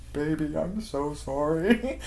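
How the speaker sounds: slow, soft mock whimper; badly faked crying, drawn out; fake tearful apology. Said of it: "he scrunches up his face, but there are no tears".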